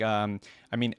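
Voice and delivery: usual speaking voice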